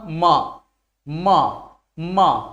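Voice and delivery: "म, म, म," each alone with lips pressed together; demonstrated lip sound. Shown as a demonstration. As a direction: repeat "म, म, म," separately to show lip closure. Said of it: "ओठ सट रहा है तो बताओ". ("म, म, म," ओठ सटाकर, अलग-अलग बोलकर दिखाते हुए)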